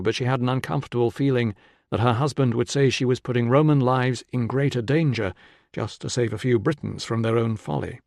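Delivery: usual speaking voice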